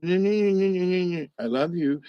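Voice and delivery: very slow, lingering love you; slow, smiling I love you to a worried friend; drawn-out tender I love you. Retold or imitated. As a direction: stretch it out fondly with a smile. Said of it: "I just smiled and I said".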